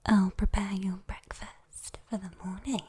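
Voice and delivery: ordinary talk, nothing marked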